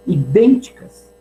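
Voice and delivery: usual speaking voice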